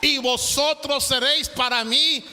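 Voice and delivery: fiery preacher crying out God's longing as a father; preaching building to a shout. Acted. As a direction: rise to a fever pitch, then stretch out Todopoderoso.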